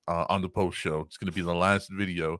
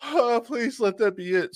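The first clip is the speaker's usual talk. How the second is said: high pleading whine; 14 semitones higher, 5 dB louder than usual. Retold or imitated. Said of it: "I'm just like".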